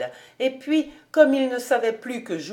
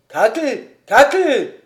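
louder, desperate call; drowning man's cry; 3 semitones higher, 8 dB louder than usual. A narrator's reading. Fritz calling the maid for rescue, loud desperate call. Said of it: "d'une voix d'homme qui se noie".

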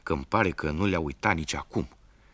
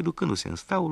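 ordinary delivery, nothing marked

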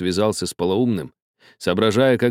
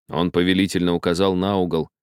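plain reading voice

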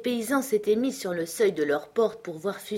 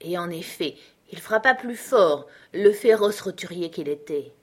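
plain reading voice

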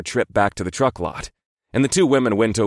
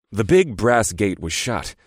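ordinary delivery, nothing marked

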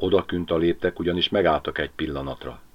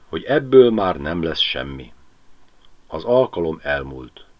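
ordinary delivery

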